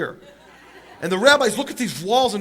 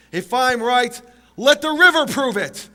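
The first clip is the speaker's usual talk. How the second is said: louder, higher, slowing on the river; shouted challenge; 6 semitones higher, 7 dB louder, slower than usual. Retold or imitated. Rabbi calling on the river to prove him, loud. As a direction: cry it out to prove him right.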